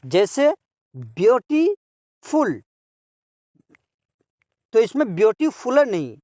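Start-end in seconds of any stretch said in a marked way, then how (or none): 0.9-2.6 beautiful slowly, with a long pause before फुल; teacher's two-beat breakdown of beautiful; split into parts. Shown as a demonstration. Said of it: "टू syllable adjectives को देखें".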